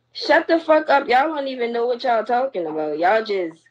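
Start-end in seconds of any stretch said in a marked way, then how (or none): 0.1-1.1 higher, sharp angry snap at the comments; sudden furious order to the commenters; angry outburst. Real, not acted. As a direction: tell them off hard. Ranting at commenters.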